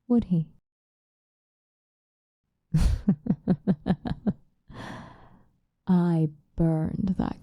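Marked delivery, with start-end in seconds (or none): 2.7-4.3 a string of short, pleased laughs; gloating chuckle. Acted. Vampire recalling revenge on the creator, gloating chuckle. Gloats over burning the castle.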